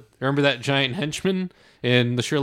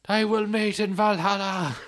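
mock screaming; 9 semitones higher than usual. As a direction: join in imitating the screaming movie hero. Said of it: "he's just screaming like".

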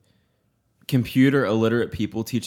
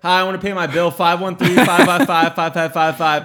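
mock sing-song customer; 4 semitones higher, 5 dB louder than usual. Mocking customer rattling off number.

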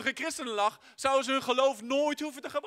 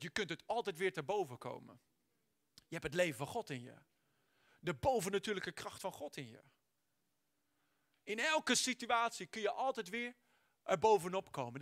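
reassuring; 4 semitones lower, 7 dB quieter than usual. Acted. Soft reassurance after shouting.

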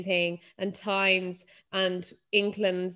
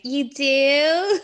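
quoting Bridesmaids; 6 semitones higher, 7 dB louder than usual. Retold or imitated. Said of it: "that scene in Bridesmaids".

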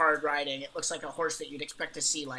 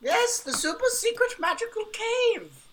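grand exclamation; 4 dB louder than usual. Acted. Knight confirms the destination, in a high grand cry. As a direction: proclaim it way up high, almost chanting.

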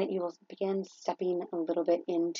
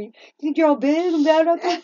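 loud, high, sassy challenge; mock taunting God; 8 semitones higher, 9 dB louder than usual. Shaking her fist at God, loud high mock taunt. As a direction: taunt the sky half-joking.

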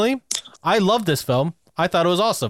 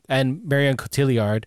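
usual speaking voice

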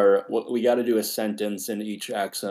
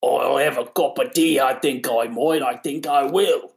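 loud, raised cartoon Brit; exaggerated, louder mock British; British accent; 4 semitones higher, 4 dB louder than usual. Shown as a demonstration. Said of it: "That's what they all sound like".

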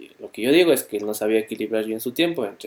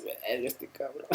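muttered under the breath, slow; whispered grumble about a friend; muttering; 21 dB quieter, slower than usual. Retold or imitated. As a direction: grumble it half to yourself. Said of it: "y así".

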